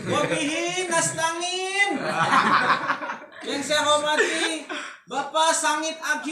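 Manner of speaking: laughing mock Javanese Arabic greeting; Javanese accent. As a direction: ham up a Javanese speaker reading Arabic. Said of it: "Kalau orang Jawa ngalamin".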